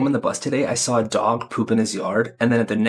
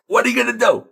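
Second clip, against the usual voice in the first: mock shrug; 9 semitones higher, 3 dB louder, faster than usual. Joking shrug.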